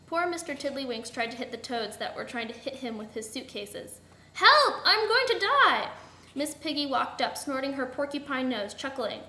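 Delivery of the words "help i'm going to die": high, loud, drawn-out panic; theatrical terrified yell; panicked cry for help. A narrator's reading. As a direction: cry out for your life. Victim calls out for help.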